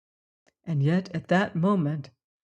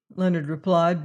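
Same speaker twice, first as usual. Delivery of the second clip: plain reading voice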